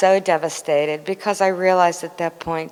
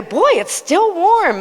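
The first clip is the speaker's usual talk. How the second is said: delighted thought; 7 dB louder, slower than usual. Retold or imitated.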